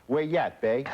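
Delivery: tossing off a New Orleans Yat hello; Yat greeting. Retold or imitated. New Orleans Yat accent as locals greet you. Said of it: "they go".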